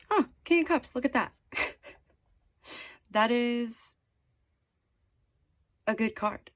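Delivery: high delighted gasp of surprise; pleasantly surprised, pitch jumps. Real, not acted. Delighted at drawn card.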